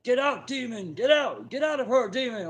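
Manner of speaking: high, loud commanding shout; shouting exorcism. Retold or imitated. Brother yelling at the possessed woman, loud shout. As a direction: cast the demon out at full volume. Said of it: "he's just shouting at her".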